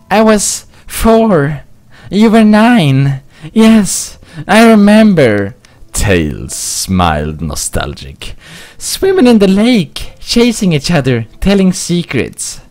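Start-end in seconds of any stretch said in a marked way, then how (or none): 0.1-5.6 put-on a kid fox remembering, pitched way up; young Tails. Tails voice, nostalgic reply. 8.8-12.6 put-on a kid fox remembering, pitched way up; young Tails. Tails reminiscing.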